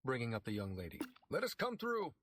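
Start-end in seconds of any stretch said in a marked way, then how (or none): 1.3-2.1 higher call to the crowd; pushing through the crowd; shouting. A narrator's reading. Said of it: "Pete shouted".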